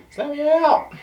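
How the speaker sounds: high squeaky voice of the trapped beer. Pretend. Reviewer voicing the beer foaming out of the can, squeaky. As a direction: play the froth begging to get out, very high.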